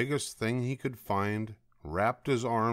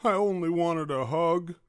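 high, louder plaintive whine; sniffly protest; 9 semitones higher, 5 dB louder than usual. A narrator's reading. Douglas told off by the owl, high hurt complaint. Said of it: "sniffed Douglas".

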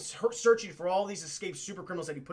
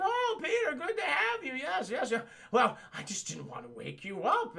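high Aunt May voice; 6 semitones higher than usual. Retold or imitated.

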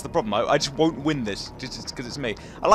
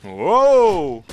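long, loud drawn-out whoa; startled whoa; 6 dB louder than usual. Real, not acted. Gamer caught out in play, startled. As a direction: cry out in surprise.

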